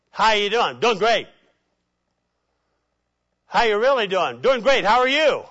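mock cheerful spirituality mask; fake cheerful. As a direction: breeze past the question with a smile. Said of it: "mask of spirituality".

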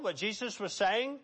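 normal talking voice

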